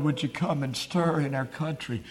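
normal talking voice

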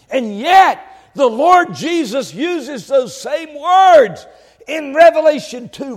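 high, loud pulpit proclamation; full-force preaching. Real, not acted. Preacher quoting Jehovah to the congregation, full-force preaching.

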